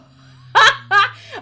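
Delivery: high loud laugh close to tears; shrieking laugh. Real, not acted. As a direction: lose it completely at the screen. Filter still on.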